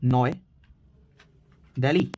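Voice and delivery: usual speaking voice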